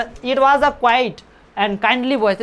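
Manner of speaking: slow, careful English reading; slow reading aloud. Shown as a demonstration. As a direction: read each word out slowly for the class. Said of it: "reading the text aloud".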